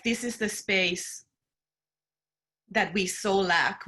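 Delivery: ordinary talk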